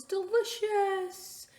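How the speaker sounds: high, slow, drawn-out delicious; delighted mom. Acted. Mom savoring her food, slow and delighted. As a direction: stretch it out with delight.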